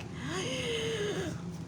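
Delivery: sharp high soft gasp. Retold or imitated. Mom retelling her reaction, high startled gasp. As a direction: gasp in mock shock. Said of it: "And I'm like".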